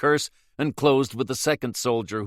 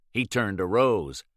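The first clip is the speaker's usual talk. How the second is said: ordinary delivery, nothing marked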